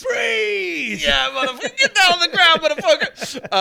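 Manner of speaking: high, loud hammed-up cop yelling; mock cop shout. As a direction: bark it like a movie cop storming in. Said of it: "there'd be loads of cops".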